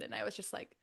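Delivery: ordinary talk, nothing marked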